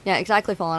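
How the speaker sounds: ordinary talk, nothing marked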